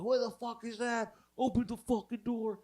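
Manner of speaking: a hammed-up angry boyfriend; mock angry yell. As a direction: bark at the door in a put-on voice.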